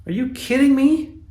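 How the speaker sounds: high incredulous cry; exasperated outburst. Real, not acted. Fan reacting to his team being outshot, high and exasperated. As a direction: let the disbelief shoot up. Fan outraged at lopsided loss.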